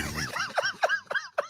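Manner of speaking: squealing burst of laughter; high shrieking laugh. Real, not acted. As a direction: let out a shrill cackle. Big laugh.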